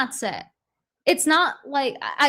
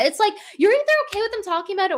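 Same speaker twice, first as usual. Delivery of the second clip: fast, higher exasperated rant; 9 semitones higher, much faster than usual. Real, not acted. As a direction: rattle it off in disbelief.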